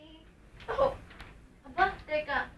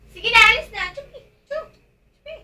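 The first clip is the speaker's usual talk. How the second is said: loud, higher shoo; shooing away; 6 semitones higher, 15 dB louder than usual. Acted. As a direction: chase them out the door. Shooing visitors out. (malakas na pagtaboy)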